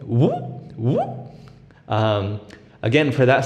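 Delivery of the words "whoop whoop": high whoop. Shown as a demonstration. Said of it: "you add a whoop".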